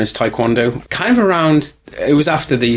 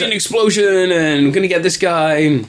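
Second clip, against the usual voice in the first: high, hammed-up pitch to a studio; mock movie pitch; 7 semitones higher than usual. Co-host acting out a pitch to Joel Silver, mock excited. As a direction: play the over-keen pitchman, voice raised.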